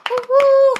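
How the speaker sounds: cheering. Real, not acted. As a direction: whoop it up for a friend. Cheering a member's milestone.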